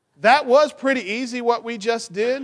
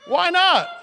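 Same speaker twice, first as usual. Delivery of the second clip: mock surprise; 9 semitones higher than usual. Mock protest at a child's no.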